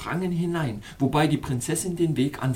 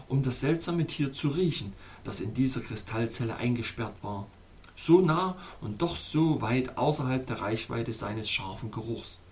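plain reading voice

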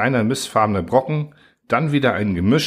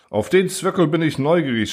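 mock shout from the crowd, pitched up; heckler shout; 8 semitones higher than usual. Crowd member heckles the officer, mock shout. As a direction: call out from the back of the hall. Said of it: "schrie einer aus der Menge".

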